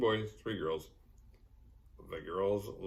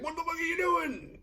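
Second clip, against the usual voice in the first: mock freak-out. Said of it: "He's like".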